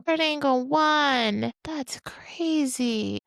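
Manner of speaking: slow, breathless whisper of disbelief; stunned whisper. Real, not acted. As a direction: whisper it in awe. Stunned reaction to result.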